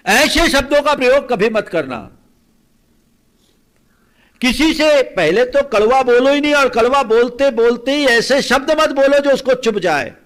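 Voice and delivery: loud, high-pitched admonition; full-force preaching. Acted. Preacher forbidding listeners to use wounding words, loud and forceful. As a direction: drive the prohibition home at full voice.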